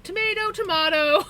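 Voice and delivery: sing-song Tomato tomato, to-MAY-to then to-MAH-to; teasing tomato tomato. Retold or imitated. Teasing the British mom with both ways, high sing-song. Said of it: "'Cause I was like".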